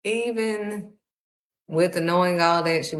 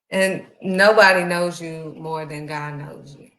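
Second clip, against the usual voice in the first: ordinary talk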